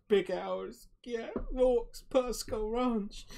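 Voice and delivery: high, halting distress; shaken young messenger. Acted. Young farmer still rattled, high faltering report. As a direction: stammer out the news in fragments. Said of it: "he looks quite shaken up".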